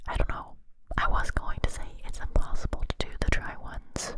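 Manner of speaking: ordinary talk, nothing marked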